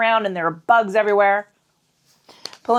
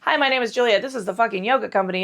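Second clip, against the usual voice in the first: ordinary talk, nothing marked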